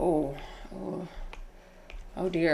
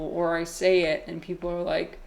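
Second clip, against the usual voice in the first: usual speaking voice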